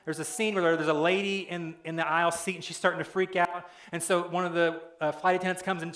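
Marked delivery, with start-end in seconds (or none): none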